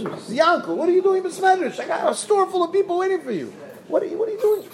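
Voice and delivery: high, shrill-pitched angry yell at husband; screaming wife. Acted. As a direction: scold him sharply from across the store. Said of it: "and she screams".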